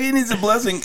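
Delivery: squeaky mock wail; high mock cry. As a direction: whine it in a high pitch. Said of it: "He's like".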